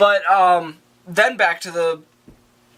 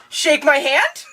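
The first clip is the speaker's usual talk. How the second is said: high, silly imagined voice; squeaky mock question, rising at the end; mock Bruce Wayne; 9 semitones higher, much slower than usual. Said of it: "But in my head, he goes".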